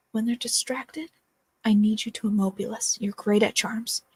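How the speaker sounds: ordinary talk, nothing marked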